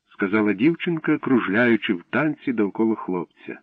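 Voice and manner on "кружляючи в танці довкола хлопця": usual speaking voice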